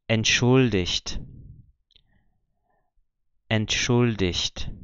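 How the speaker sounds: entschuldigt slowly, ending in -icht; slow model of entschuldigt, G as CH; ch sound for G. Shown as a demonstration. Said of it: "continua com a pronúncia do C H".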